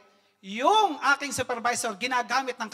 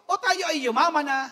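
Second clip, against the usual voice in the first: ordinary talk, nothing marked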